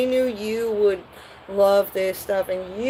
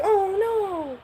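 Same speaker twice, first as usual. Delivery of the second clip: put-on high, slow squeal of a delighted kid; excited child voice; 13 semitones higher, slower than usual. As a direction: gush over the gift like a little kid. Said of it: "you're all excited".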